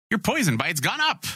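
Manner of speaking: high chirpy announcer; game system voice. Retold or imitated. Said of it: "it's like".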